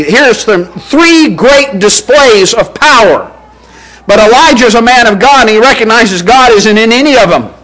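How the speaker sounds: ordinary talk, nothing marked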